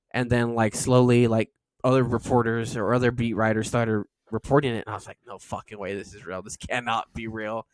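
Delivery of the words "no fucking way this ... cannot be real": much softer, stunned and hushed; stunned disbelief. Retold or imitated. Fan realizing Devers is really gone, hushed stunned disbelief. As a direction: let it fall out of you, barely believing.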